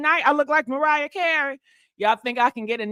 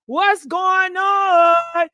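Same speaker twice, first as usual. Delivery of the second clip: drawn-out hype greeting; 5 semitones higher, 3 dB louder, much slower than usual. Real, not acted. Greeting live viewers, calling out.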